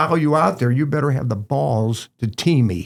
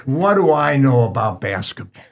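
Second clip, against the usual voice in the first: mock sarcastic coach; 4 dB louder, much slower than usual. Coach mocking the young referee, sarcastic. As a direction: scoff at the ref like you know better. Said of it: "He goes".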